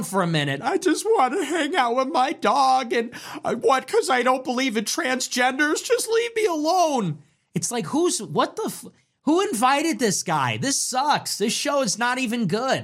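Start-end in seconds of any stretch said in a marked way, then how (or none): 0.6-7.2 whiny mock singer. Parodying the Turning Point singer. 7.5-12.8 higher, scornful griping; exasperated high complaint. Real, not acted. Ranting at halftime show.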